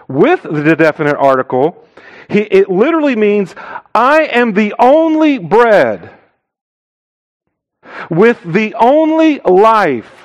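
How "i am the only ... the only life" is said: "only" drawn out and pitched high; raised, slow line, leaning hard on "only"; stress on only. Shown as a demonstration. Stressing only in literal translation.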